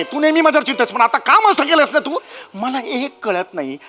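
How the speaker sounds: ordinary talk, nothing marked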